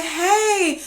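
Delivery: high, drawn-out hello; greeting back. Retold or imitated. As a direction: return the party greeting in a lilt. Acts out own reply to cousin.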